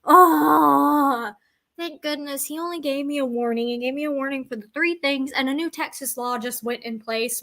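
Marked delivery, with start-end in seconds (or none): 0.1-1.3 crying groan. Retold or imitated. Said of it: "I'm sitting there going".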